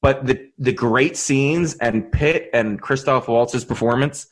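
ordinary talk

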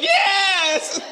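high, drawn-out squealed yes; excited church lady. Retold or imitated. Preacher mimicking the excited minister lady's shout, high and excited. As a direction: imitate an over-excited cheer, stretched out long. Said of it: "she always sounds excited".

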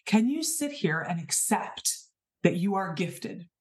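ordinary delivery

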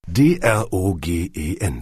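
slow, letter by letter; deliberate spelling. A narrator's reading. Kunze proving he can spell it, deliberate. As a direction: spell it out slowly and evenly.

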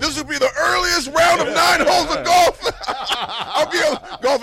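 loud laughing mock; laughing shout.

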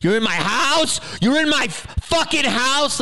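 high-pitched indignant outburst, imitating his anger; angry outburst. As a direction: imitate an outraged homeowner, voice jumping way up. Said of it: "he was so angry".

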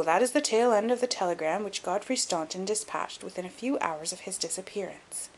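usual speaking voice

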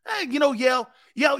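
high, loud call across the room; loud hey to a colleague. Real, not acted. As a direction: shout over to Yale to get his attention. Calling out to co-host.

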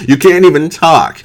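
laughing disbelief. Real, not acted. Laughing disbelief at the retold rant.

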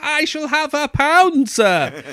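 put-on a haughty child lording it over the shopkeeper; haughty posh kid. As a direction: demand it grandly, like a spoilt little lord. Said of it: "hands on your hips and go".

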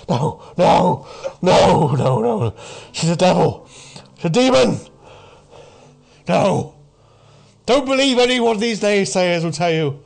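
loud, high, desperate insistence; distressed doomsayer. Acted. Doomsayer with hand to his head, shouting in distress. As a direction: cry out the warning, pleading to be believed. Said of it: "you can clearly see he's in distress".

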